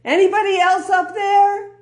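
high, loud, panicked wail; mock despairing cry to the sky; David seeks a second opinion from heaven; desperate cry. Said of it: "And David looks back up and he says".

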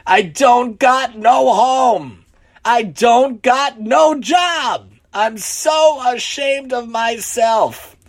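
high, slow, loud wail; drawn-out, acted lament at high pitch; sobbing. A narrator's reading. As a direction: wail each line out like a sobbing drunk. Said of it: "he began to sob".

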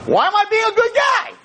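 put-on high shouted complaint of a good kid; indignant shout. Good kid shouting at unfair rewards, indignant high shout. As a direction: cry out that being good goes unrewarded.

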